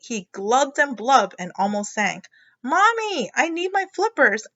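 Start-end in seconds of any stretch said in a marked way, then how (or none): 2.6-4.5 high, pleading little frog; pleading child. Acted. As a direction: call to mom like a desperate kid.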